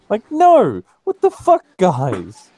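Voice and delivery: loud, high outraged yell. Real, not acted. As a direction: shout your disbelief. Outraged rant.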